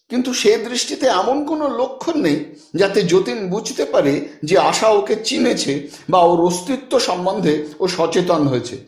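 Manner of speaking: usual speaking voice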